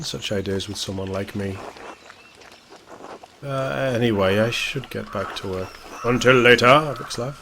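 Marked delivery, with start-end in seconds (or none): 6.0-7.4 loud farewell. Acted. NPC parting call.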